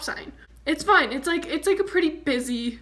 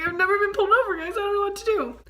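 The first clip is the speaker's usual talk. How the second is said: panicky; 7 semitones higher, faster than usual. Retold or imitated. Panicked quote of herself.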